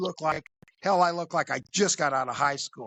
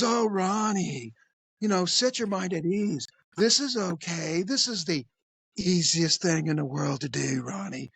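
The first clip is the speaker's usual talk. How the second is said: South Park creep; 3 semitones higher than usual. Retold or imitated. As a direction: soothe like a cartoon predator. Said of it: "That's how he talked".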